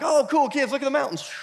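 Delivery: high-pitched, casual mock enthusiasm; breezy parent. Parent to kids while driving past scenery, breezy mock wonder. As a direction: toss it off in a high voice.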